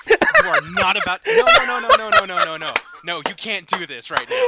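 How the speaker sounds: laughing, high, loud protest; excited no no no. Real, not acted. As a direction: yell no over and over, laughing. Laughing outburst over a card clause.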